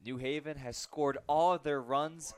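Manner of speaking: ordinary talk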